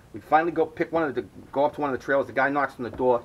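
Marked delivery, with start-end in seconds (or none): none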